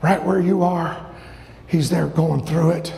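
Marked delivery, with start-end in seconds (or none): none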